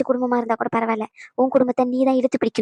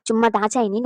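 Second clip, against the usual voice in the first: normal talking voice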